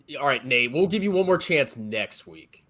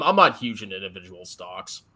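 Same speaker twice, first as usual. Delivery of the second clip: usual speaking voice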